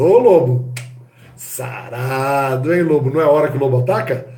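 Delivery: flirt with the wolf; flirty. Acted. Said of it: "houve um jogo de sensualidade ali".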